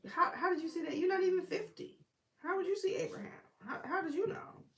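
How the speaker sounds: high, squeaky disbelieving questions; comic baffled doubter; mock incredulity. Acting out incredulous questioners of Jesus.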